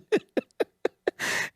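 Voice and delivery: airy giggle at his own joke; a high wheezy cackle; laughing hard at the toilet paper line; high breathy laugh. Real, not acted.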